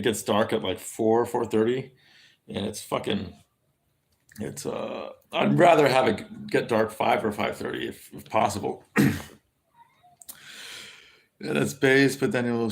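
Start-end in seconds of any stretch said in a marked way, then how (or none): none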